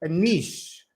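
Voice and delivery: niche softly said as "neesh", with a long ee and an sh ending; quiet teacher's model of niche as "neesh"; correct niche. Shown as a demonstration. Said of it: "It really is like niche".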